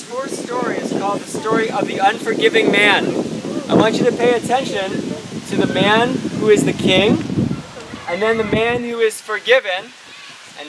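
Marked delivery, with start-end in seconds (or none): none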